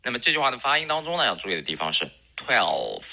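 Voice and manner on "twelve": twelve slow with a dark L. Shown as a demonstration. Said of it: "dark L".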